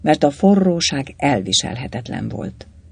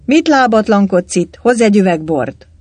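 loud, higher-pitched scolding command; brusque order; 6 semitones higher, 6 dB louder than usual. A narrator's reading. Galamb sending the canteen waiter for wine, brusque and loud. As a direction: bark the order at a servant.

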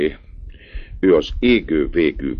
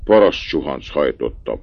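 plain reading voice

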